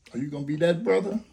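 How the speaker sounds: normal talking voice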